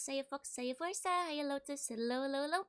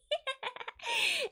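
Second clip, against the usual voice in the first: high burst of laughter. Real, not acted.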